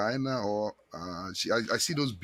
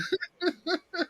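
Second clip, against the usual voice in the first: high laugh. Real, not acted. Host laughs at doctor's joke.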